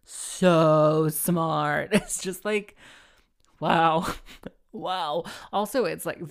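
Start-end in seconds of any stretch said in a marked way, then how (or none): 0.0-1.9 louder, slow put-on voice of a smug lead; smug lead's hammy boast; mock self-praise. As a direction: puff yourself up, slowly. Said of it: "And then to be like".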